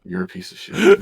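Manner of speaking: mock insult.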